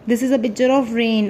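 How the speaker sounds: usual speaking voice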